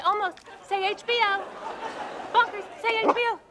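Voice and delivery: coaxing the dog. Acted. Coaxing her dog to speak.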